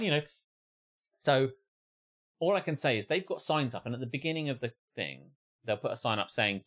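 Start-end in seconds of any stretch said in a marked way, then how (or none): none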